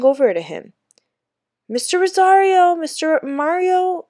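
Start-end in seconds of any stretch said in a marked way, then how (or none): 1.7-4.1 dazed calling. A narrator's reading. Hurt boy recalls the teacher's name.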